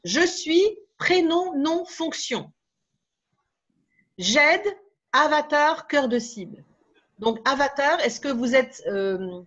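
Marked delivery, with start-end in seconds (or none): 0.0-6.6 slow, higher, each slot set apart; template recital. Shown as a demonstration. Trainer dictates the pitch template to the audience, slowly. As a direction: lay out the pitch template like a formula. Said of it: "un pitch".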